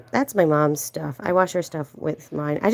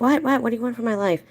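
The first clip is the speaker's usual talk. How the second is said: groggy exasperation; 6 semitones higher, faster than usual. Retold or imitated. Woken at night by cat.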